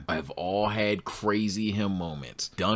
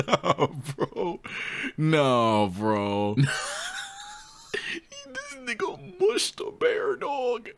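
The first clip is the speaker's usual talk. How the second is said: high squealing laugh through words; can't hold it together; laughing hard in disbelief; much slower than usual. Real, not acted. Cracking up at bear mushing.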